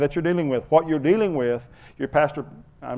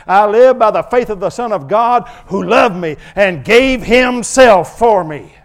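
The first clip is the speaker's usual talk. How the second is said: impassioned preaching; 8 semitones higher, 10 dB louder than usual. Acted. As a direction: proclaim the verse with rising fervour. Impassioned scripture proclamation.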